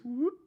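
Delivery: squeaky high pop-up whoop; a sudden high whoop. Retold or imitated. Imitating Vincent popping up.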